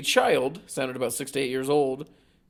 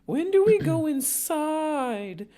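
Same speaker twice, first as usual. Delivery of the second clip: put-on high, slow robotic kid voice; a robot mimicking a child; slower than usual. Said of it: "sounded like a robot trying to mimic a child".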